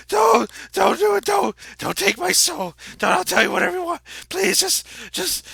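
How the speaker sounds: shrieking high-pitched plea; terrified begging. Acted. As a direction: beg for your life, screaming. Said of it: "and he's like".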